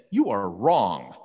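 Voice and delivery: low, slow, weighty rebuke; acted-out grave public rebuke; stern confrontation. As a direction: drop low and spell out the verdict. Paul publicly confronts Peter, acted.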